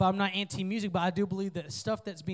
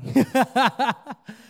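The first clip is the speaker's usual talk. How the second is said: loud burst of real laughter; 4 semitones higher, 10 dB louder than usual. Real, not acted. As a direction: let a big real laugh out. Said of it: "make fun of me laughing".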